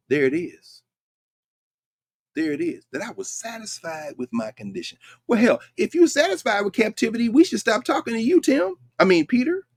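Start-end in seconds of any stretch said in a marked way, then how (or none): 5.3-9.7 much higher, scoffing at him; high-pitched scoffing jab; incredulous mockery. Real, not acted. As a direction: ridicule his contentment with captivity. Mocking the slave narrator.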